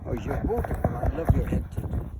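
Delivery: usual speaking voice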